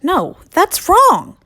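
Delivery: high, loud, slow protest; calling out to stop them. Acted. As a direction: call out as an alarmed hen. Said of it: "Hen one called out to stop them".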